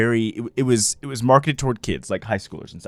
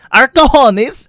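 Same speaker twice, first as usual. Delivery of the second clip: put-on high, loud comic foreign accent; Borat; 13 semitones higher, 10 dB louder, much slower than usual. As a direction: proclaim it in a parody Borat voice. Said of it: "This message brought to you by Borat".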